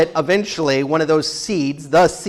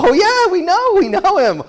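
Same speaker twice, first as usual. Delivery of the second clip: much higher and louder, delighted; eager herders; 14 semitones higher, 4 dB louder than usual. Retold or imitated. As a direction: jump in eagerly, oh yeah. Herders eagerly answer Jacob.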